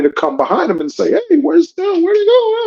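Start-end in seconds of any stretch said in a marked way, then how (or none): 1.3-2.5 imitated high-pitched frantic parent calling out; frantic searching. Imagined parent running up behind the child, frantic searching. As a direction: call after a lost kid, pitched way up.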